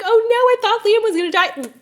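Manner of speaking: high, fast hammed-up panic through a laugh; imitating a worried friend, laughing; mock panicked. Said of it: "and you're like".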